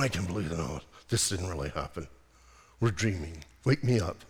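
a friend barely able to speak from disbelief; stunned disbelief. Pretend. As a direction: murmur it like you're in shock. Friend's stunned reply at tomb.

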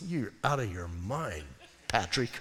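low, quiet, dry rebuke; voice of God. Retold or imitated. Preacher voicing God turning down his big request, low and quiet. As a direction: drop low and soft, amused reproach. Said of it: "and he goes".

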